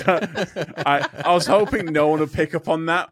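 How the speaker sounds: high, loud embarrassed laughing. Real, not acted. Embarrassed laughter at own slip.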